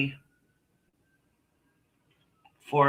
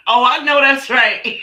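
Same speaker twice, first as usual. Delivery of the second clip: loud, high burst of laughing approval; high-pitched rowdy cheer; whooping agreement; 10 semitones higher, 11 dB louder than usual. Real, not acted. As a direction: holler it out through a laugh. Cutting up, laughing with viewers.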